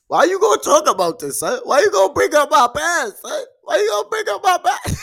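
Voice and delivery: loud, high, rising into a sob; fake crying. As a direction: shout it as if about to cry. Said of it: "I'm a great actor. I was about to cry".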